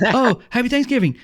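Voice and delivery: high-pitched acted realisation, then friendly; pleasantly surprised greeting. Shooter returning the holiday wish, high surprised friendliness. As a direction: return the wish as if it just clicked.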